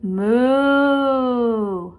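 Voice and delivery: cartoon cow moo. Shown as a demonstration. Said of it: "Which animal says".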